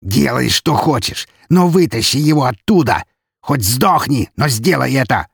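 fierce, forceful demand; enraged. A narrator's reading. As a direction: drive each command home in a rage. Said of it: "прорычал взбешённый граф".

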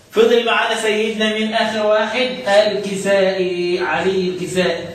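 normal talking voice

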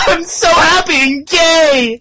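high, slow, loud giggling; laughing squeal. Acted.

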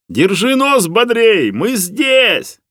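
high, slow, carrying shout; loud call. A narrator's reading. Rescuer shouts to the man trapped below, loud call. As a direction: holler encouragement to someone far below. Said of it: "громко крикнул Лёха".

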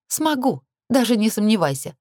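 high, scoffing assurance; lightly scornful confidence; snorted assurance. A narrator's reading. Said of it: "фыркнула".

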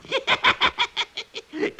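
falling witch's cackle. Acted. Witch laughs at the friends over her clever trick, smug cackle. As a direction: cackle at your own cleverness.

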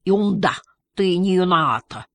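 garbled words round a mouthful of muesli; mimed full-mouth mumbling, vowels smeared. A narrator's reading. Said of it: "с ртом полным мюсли".

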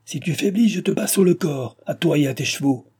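high-pitched, urgent command; threatening cry. A narrator's reading. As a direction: drive them on with a threat. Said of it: "criait le voyageur".

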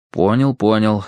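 ordinary delivery

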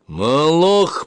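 sudden resonant cry; booming exclamation. A narrator's reading. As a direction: boom the word out. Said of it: "прогудел он".

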